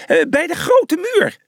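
high, eager call; excited. A narrator's reading. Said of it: "zei hij opgewonden".

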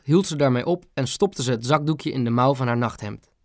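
ordinary delivery, nothing marked

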